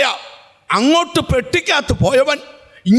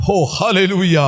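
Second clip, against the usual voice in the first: ordinary delivery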